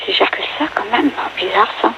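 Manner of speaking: muttering. Real, not acted. Contestant mutters to herself.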